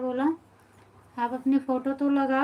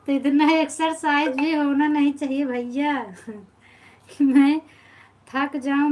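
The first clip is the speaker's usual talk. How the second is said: laughing through the words, louder; 6 dB louder than usual. Real, not acted. Joking to a viewer about too much exercise, laughing loudly. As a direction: chuckle while protesting, a bit louder.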